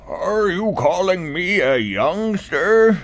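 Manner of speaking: put-on high, slow, creaky old complainer; old tortoise voice. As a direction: groan it out like an aching old tortoise.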